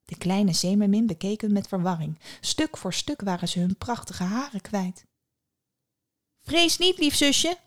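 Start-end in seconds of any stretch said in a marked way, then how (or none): 6.4-7.6 much higher voice of a sister; eldest sister calling. A narrator's reading. Eldest sister to the little mermaid from the water, calling out. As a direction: call out high to reassure her. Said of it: "riep de oudste".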